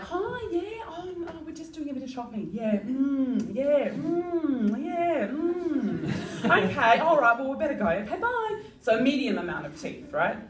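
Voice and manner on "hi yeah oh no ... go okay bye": polite acquaintance. Retold or imitated. Passing a semi-known acquaintance, polite and high. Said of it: "you'll be like".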